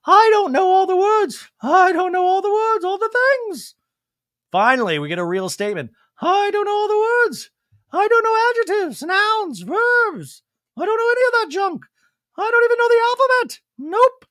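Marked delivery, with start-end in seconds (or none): 0.1-3.7 very high, loud mock voice; hammed-up high impression, pushed hard; Dorit. Dorit protests that she lacks words, very high mocking impression. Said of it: "Dorit goes". 6.2-14.2 very high, loud caricature; Dorit. Retold or imitated. Dorit disowns adjectives and the alphabet, high hammed-up protest. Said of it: "Dorit goes".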